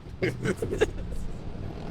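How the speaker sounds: a tiny dog yapping; yappy chihuahua bark. Acted. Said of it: "why does it sound like a chihuahua".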